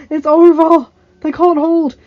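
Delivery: high, very loud protest; full-force cry of defeat; despairing shout. Acted. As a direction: yell that the fight is lost. Bannerman despairs, shouting over battle.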